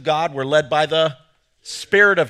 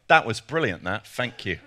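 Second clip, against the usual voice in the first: put-on playful British accent with "fank you" for "thank you"; cheeky mock-British thanks; 6 semitones lower than usual. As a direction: put on a jokey English accent. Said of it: "in my native tongue".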